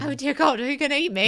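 hammed-up fright; mock terror. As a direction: pretend to be scared stiff.

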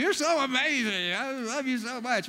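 put-on slurred drunk gushing; mock drunk, words slurred; drunk slurring. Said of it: "slurring your words".

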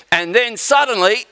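high, suspenseful announcer build; dramatic cliffhanger. Acted. Preacher mimics a TV serial breaking off, dramatic cliffhanger. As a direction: leave them hanging on a sudden twist.

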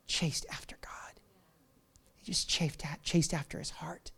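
slow hushed whisper on chased. Real, not acted. Pastor hushing the congregation on the key point, slow whisper. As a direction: breathe it out slowly.

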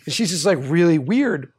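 usual speaking voice

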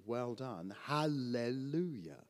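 ordinary talk, nothing marked

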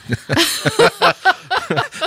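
squealing laugh up high. Real, not acted. Co-host laughs at the cartoon cops with a high squeal. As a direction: let a high-pitched laugh out.

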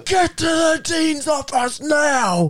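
high, slow, drawn-out mock bellow; angry teacher. Teacher sending the kid to the dean, angry. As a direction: act out a teacher shouting an order. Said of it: "he gets so angry".

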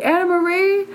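high, drawn-out mock holler; husband calling her back. Husband summoning wife back into her body, high urgent call. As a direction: call someone back from far away, pitched high. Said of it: "I hear my husband's voice going".

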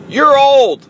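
mock angry yell, high and loud; frustrated kid's outburst. Son getting out of the car, imitated frustrated yell. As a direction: imitate a fed-up son hurling an insult. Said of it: "got super frustrated and mad at me".

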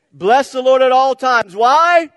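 yelled high and loud, really meaning it; preacher leading a loud chant; shouted call. Real, not acted. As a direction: shout it back to the church. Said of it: "You guys gotta say it like you mean it".

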